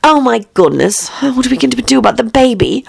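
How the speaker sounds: ordinary delivery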